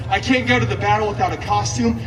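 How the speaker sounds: ordinary talk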